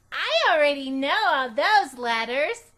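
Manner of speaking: put-on high, loud little girl boasting; a proud kindergartner showing off; Junie B voice. A narrator's reading. Girl boasting to her new teacher, loud and high. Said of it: "I said".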